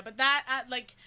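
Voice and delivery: ordinary talk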